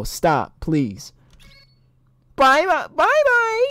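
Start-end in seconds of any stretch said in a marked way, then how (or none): 2.3-3.7 very high, loud cartoon voice; squeaky goodbye. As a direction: squeal it like a tiny character. Voicing a game character's goodbye.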